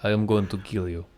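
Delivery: put-on soft, deadpan Russian accent threat. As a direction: menace it quietly in a Russian accent. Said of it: "in a Russian accent".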